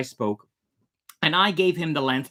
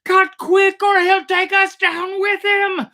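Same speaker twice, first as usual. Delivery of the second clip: very high, loud desperate order; frantic shout; 8 dB louder than usual. A narrator's reading. Begging the crew to cut the line, frantic high shout. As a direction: scream the order before it drags you under. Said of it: "I shouted".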